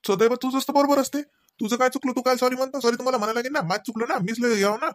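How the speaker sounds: high-pitched, aggrieved mock concession; sarcastic scolding. Husband sarcastically taking the blame in a quarrel, high-pitched scolding. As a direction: complain sarcastically, pitched well up.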